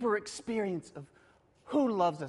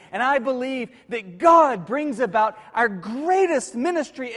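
louder, rising conviction on every God; preaching at full force; 2 semitones higher, 7 dB louder than usual. Acted. Preacher declaring trust in God to the congregation, full-force preaching. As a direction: proclaim it, hammering each God.

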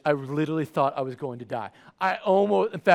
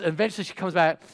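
ordinary talk

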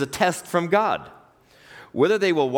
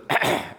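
acted attention-getting throat clear; short, deliberate ahem; pointed throat clearing; 4 semitones higher than usual. Boss interrupting an employee, pointed throat clearing.

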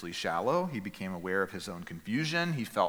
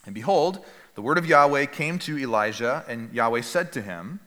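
solemn scripture reading; 3 semitones higher, 6 dB louder than usual. Acted.

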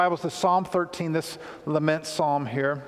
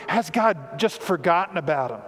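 normal talking voice

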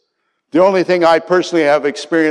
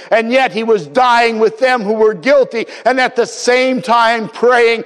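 raised, fervent preaching voice; preaching at full force; 9 semitones higher, 4 dB louder than usual. Acted. Preacher pleading with congregation, fervent and raised. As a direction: build the passion, pressing each phrase.

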